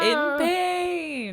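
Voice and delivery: high, drawn-out pained wail; mock howl. Co-host joins Ice King's howling, mock pained wail. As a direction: wail the words in mock agony. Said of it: "starts howling".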